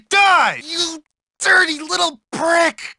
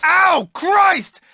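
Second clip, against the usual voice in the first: pained outburst; 5 semitones higher than usual. Acted. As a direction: cry out from a sudden hit. Hurt during a fight.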